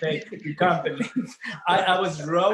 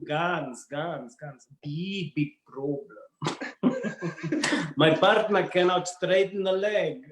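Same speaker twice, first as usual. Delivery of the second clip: mock Russian complaint; exaggerated Russian accent; slower than usual. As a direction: grumble in a thick Russian accent. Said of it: "Russian accent".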